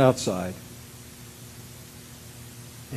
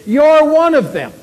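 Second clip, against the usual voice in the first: high, slow, loud accusing cry; loud accusation; 12 semitones higher, 11 dB louder, slower than usual. Acted. Someone else spots Peter, loud accusing cry. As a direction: call him out in a raised voice.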